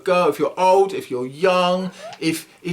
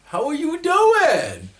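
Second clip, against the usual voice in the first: high, slow, silly puppet greeting; 11 semitones higher, much slower than usual. Acted. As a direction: stretch it out like a puppet. Puppet Sparky greets children.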